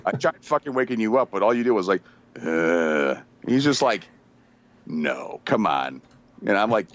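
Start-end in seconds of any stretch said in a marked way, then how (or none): 2.4-3.2 low mock groan; sleepy groan. Drunk man being woken up, imitated groan. 4.9-6.0 low, mock-dismayed denial; disbelieving denial. Friend told he peed there, low disbelief.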